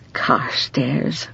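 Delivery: whispered name, rising softly; soft private whisper of the name. Shown as a demonstration. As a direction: breathe the name to herself. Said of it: "said again as a whisper".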